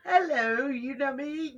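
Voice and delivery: play-acting. Acting out Tina's reply.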